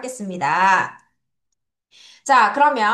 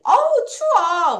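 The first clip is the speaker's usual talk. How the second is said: higher, slow cry of feeling cold; acted chilly exclamation; 6 semitones higher, 4 dB louder, slower than usual. Teacher cues learners to say it's cold, acted chilly exclamation.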